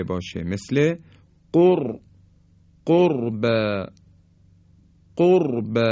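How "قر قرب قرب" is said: قر، قرب with a thick R after the u; heavy ra. Shown as a demonstration. Teacher showing silent ra after damma, heavy in قرب. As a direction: hit قرب firmly, ra full and heavy. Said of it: "زمانی است که حرف را ساکن".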